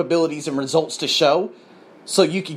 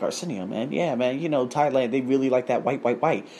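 softer, low confiding aside; hushed confiding; 5 semitones lower, 6 dB quieter than usual. Retold or imitated. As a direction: murmur it like a secret. Coworker confiding quietly.

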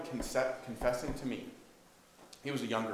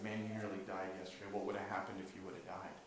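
much softer and quicker, leaning in; hushed earnest; 6 semitones lower, 13 dB quieter, faster than usual. Retold or imitated. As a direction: ask it low and urgent. Earnest question to the man.

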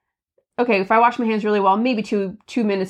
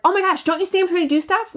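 higher, fast, exasperated mom voice; frustrated outburst; 8 semitones higher, faster than usual. Retold or imitated. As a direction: rattle it off high like a frazzled mom. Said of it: "So now I'm frustrated".

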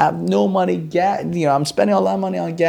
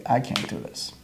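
defeated whisper; 4 semitones lower, 8 dB quieter than usual. Retold or imitated. Quitting, handing back keys.

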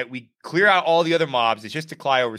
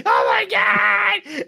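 very high, slow, drawn-out shocked cry; horrified gasp; much slower than usual. Retold or imitated. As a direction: stretch it out in stunned horror. Ogre sees his friend's blood.

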